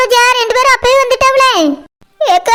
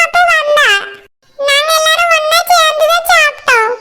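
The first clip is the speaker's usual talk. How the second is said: put-on child voice; 4 semitones higher than usual. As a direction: answer like a small child telling mom what happened. Child answering the mothers.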